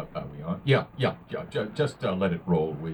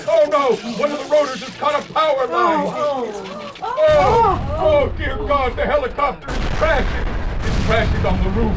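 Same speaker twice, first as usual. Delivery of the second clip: higher, louder, rising panic; horrified report; 12 semitones higher, 6 dB louder than usual. Acted. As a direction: cry out as it goes down. Reporter watches chopper crash.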